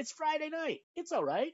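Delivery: high, lilting tempter's voice; coaxing shoulder demon. Acted. Demon on his shoulder excusing a Friday indulgence, high coaxing voice. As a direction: talk them into it, high and wheedling. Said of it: "little demon on my shoulder who's just whispering in my ear".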